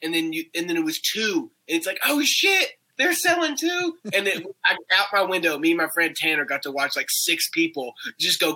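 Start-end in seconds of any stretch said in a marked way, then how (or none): 2.0-4.0 high-pitched shocked exclamation; amazed onlooker. Retold or imitated. Mimicking watchers learning more classmates sell drugs, amazed. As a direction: act out astonished discovery, pitched way up.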